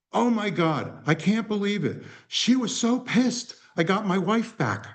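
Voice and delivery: high, thrilled disbelief; overjoyed. Retold or imitated. Husband phoning to say he got his wife back, thrilled. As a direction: gush into the phone with joy.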